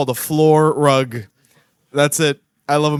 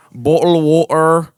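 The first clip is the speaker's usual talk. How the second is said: mock British accent; 3 dB louder than usual. Speaker mocking British English as unclear, mock British accent. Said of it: "British English is not clear".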